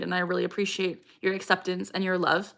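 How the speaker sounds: usual speaking voice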